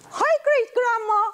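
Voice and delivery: high, bright great-grandchild greeting; child voice. Acted. Great-grandchild greeting great-grandma in a high child voice. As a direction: play the little great-grandchild. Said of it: "this is Jackie and this is John".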